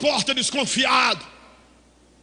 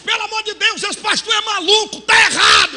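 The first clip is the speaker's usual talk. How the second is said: higher, louder protesting mother; a mother scolding the pastor, pitched up; indignant mother; 6 semitones higher, 5 dB louder than usual. Retold or imitated. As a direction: object like an outraged mother.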